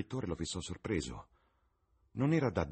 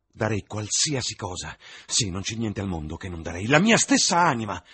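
higher and louder, growing desperate; anguished outburst; 5 semitones higher, 9 dB louder than usual. Acted. Dorian davanti al suo ritratto, disperato e acceso. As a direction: plead against growing old, voice rising.

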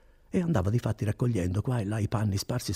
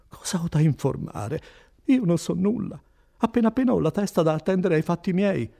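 higher, put-upon innkeeper; protesting ignorance; 7 semitones higher, 4 dB louder than usual. Acted. Innkeeper questioned by the notary, protesting. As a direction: insist you know nothing, pitch raised.

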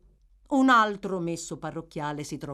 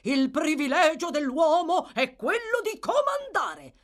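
pompous husband; 9 semitones higher, 4 dB louder than usual. A narrator's reading. Bumble lording it.

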